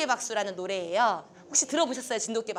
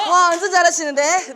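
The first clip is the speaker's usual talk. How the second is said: excited praise; 6 semitones higher, 9 dB louder than usual. Real, not acted. Praising group after the game.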